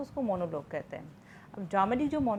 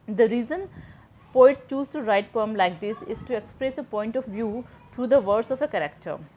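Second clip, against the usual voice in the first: slow and louder, reading a textbook line; slow, louder dictation reading; 6 dB louder, much slower than usual. Shown as a demonstration. Teacher to students, reading a textbook line slowly and louder. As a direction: read it out slowly and louder for notes.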